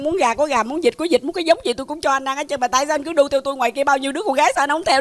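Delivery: fast, breathless comic tirade; pleading, fed-up rapid-fire rant; exasperated rant. Acted. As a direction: rattle off the complaints without a pause. Exasperated rant at persistent suitor.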